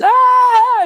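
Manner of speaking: high squealing "Ah!" shriek; kids' shriek. Retold or imitated. Kids rush dad at the door, high squealing shriek.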